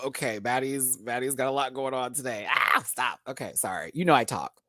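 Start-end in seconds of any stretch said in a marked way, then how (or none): none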